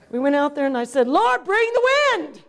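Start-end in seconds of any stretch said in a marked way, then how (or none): 1.1-2.4 calling out in prayer. Retold or imitated. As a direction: call the wind down from heaven. Calling on God for wind.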